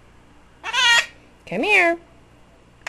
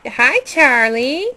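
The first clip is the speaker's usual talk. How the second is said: ordinary talk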